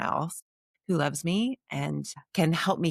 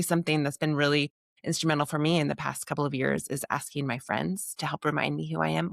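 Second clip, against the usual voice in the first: normal talking voice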